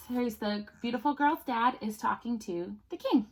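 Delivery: plain reading voice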